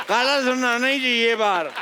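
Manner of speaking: put-on slow, slurred drunken boast. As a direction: drag it out like a drunk. Drunker man after more drinks.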